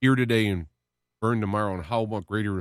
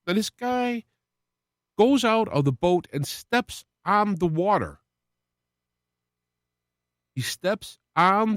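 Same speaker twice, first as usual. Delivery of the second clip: preaching at full force; 6 semitones higher than usual. Acted. Preacher telling listeners of Peter walking on water, fervent and rising. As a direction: drive the miracle home to the flock.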